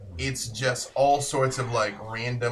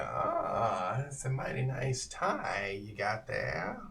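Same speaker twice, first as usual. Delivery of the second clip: softer, slow, lightly voiced old stranger; creepy old man bargaining; 8 dB quieter, slower than usual. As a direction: coax the trade a little, softly. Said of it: "and he's like".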